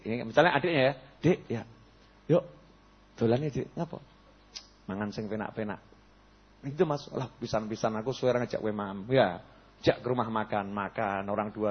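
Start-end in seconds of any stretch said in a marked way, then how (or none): 1.2-5.8 softer, easy coaxing to a younger brother; relaxed gentle invite. Retold or imitated. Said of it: "nyampaikannya nyantai". 7.1-9.1 softer, easy coaxing to a younger brother; relaxed gentle invite. Retold or imitated. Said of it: "nyampaikannya nyantai".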